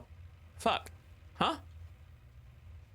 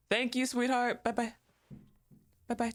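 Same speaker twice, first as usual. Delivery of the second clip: high, sugary thank-you to a cute character; sweet cooing thanks; 7 semitones higher than usual. Real, not acted. Streamer sweetly thanking Mita for the key, high coo. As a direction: coo at her like a pet.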